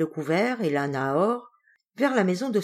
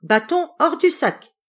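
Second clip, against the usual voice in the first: higher, louder cry at the stick; shouted command; 9 semitones higher, 6 dB louder than usual. Acted. The turner catches the innkeeper, shouts the spell. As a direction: yell the magic words at the thief. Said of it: "il cria".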